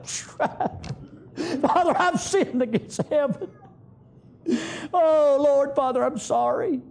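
a sinner's high broken plea; pleading son. Acted.

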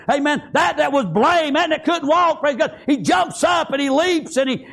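high, sustained pulpit fervour; full-force preaching. Acted. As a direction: preach it in driving, high-pitched waves.

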